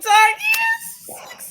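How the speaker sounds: very high, whooping yes; excited squeal. Real, not acted. Co-host cued to name BTG After Dark, excited squeal. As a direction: squeal it in delight.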